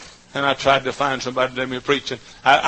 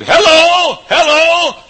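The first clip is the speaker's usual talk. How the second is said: loud, much higher hollered hello; calling out; 13 dB louder, much slower than usual. Retold or imitated. Preacher calling at a country church door, hollering. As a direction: holler to raise someone at the door. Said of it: "that's ringing the doorbell down in East Texas".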